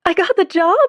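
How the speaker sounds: high, loud, thrilled; excited disbelief. A narrator's reading. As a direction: squeal the news in disbelief. Learning she got job.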